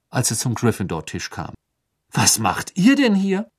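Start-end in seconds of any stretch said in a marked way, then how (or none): 2.1-3.5 slow, higher, astonished question; puzzled. A narrator's reading. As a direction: ask it slowly, still baffled. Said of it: "vollkommen verdutzt". (verdutzt und langsam, höher gefragt)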